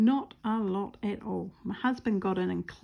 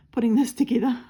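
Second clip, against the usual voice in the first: laughing louder mid-sentence; 4 semitones higher, 9 dB louder, faster than usual. Real, not acted. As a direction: laugh through it. Laugh heard on line.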